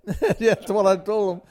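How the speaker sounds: laughing high and loud. Real, not acted. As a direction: laugh through the words. Laughs while answering.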